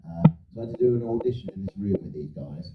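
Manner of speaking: normal talking voice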